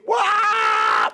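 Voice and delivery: pig's high flying wail. Acted. Pig swept away by the wind, high wail. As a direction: let out a falling pig's wail.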